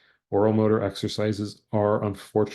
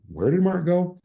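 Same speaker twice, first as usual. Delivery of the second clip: much higher and louder question; lifted example question; 11 semitones higher, 6 dB louder than usual. Shown as a demonstration. Teacher voicing a sample question, high and loud. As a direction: ask it brightly, pitched way up.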